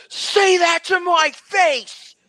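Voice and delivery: high, drawn-out angry shout; furious challenge. Acted. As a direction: roar it as a challenge. Knight draws blade, challenges accuser.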